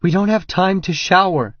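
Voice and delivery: high, loud panicked scream. A narrator's reading. Regan fighting him in the shower, screaming in panic. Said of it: "she screams at me".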